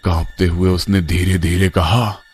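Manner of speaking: ordinary delivery, nothing marked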